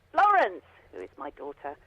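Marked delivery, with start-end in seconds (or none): 0.1-0.6 loud, high call of a name; retold shout upstairs. Mum shouting up the stairs to her daughter, retold call. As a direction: re-enact calling a child from downstairs. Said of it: "I usually shout up the bottom of the stairs".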